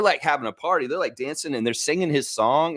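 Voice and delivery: ordinary delivery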